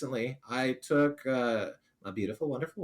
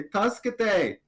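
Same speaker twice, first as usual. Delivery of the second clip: mock cry for help, pitched up; grito de socorro encenado; 7 semitones higher, 4 dB louder than usual. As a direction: call out for help in Japanese, higher. Acted call for help in Japanese.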